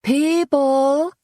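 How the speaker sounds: high, pushed call to the room; a high, loud hissed call; loud stage whisper. Acted. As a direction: project it while pretending to hush. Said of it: "hissed in a loud stage whisper".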